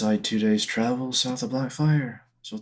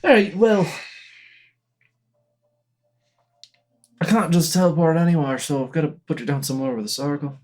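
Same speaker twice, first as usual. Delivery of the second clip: higher, louder game character voice; Otto, brusque and impatient; 6 semitones higher, 4 dB louder than usual. Acted. Otto asking the party for a map, brusque and impatient. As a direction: pitch it up, forceful and exasperated.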